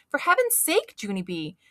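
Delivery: high, loud scolding; exasperated yell. Acted. Mother stopping child's screaming, exasperated loud yell. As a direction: snap at the wild child. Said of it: "she yelled".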